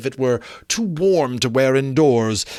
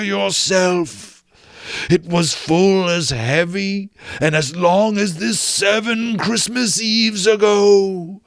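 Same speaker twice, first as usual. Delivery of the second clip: higher, slow wailing moan that swells loud; ghost lamenting; 6 semitones higher, 4 dB louder, slower than usual. Acted. Marley's ghost explaining his doom, slow wailing ghost voice. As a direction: mourn it like a doomed spirit.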